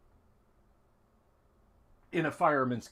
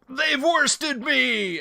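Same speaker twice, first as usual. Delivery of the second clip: high, drawn-out dying cry; hammed-up, slow last words of a fallen hero; 11 semitones higher, 4 dB louder, much slower than usual. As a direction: cry out in defeat as you fall. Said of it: "Fang cries out".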